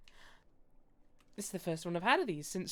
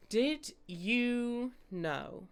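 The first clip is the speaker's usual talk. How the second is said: slow; 5 semitones higher, much slower than usual. Acted. Teasing fake crab fact.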